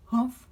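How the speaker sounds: loud sudden grunt waking up; jolted awake with a grunt; startled humph. Acted. Father jolted awake by Frances at his bedside, grunting.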